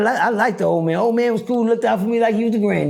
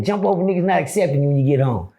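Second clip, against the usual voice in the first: normal talking voice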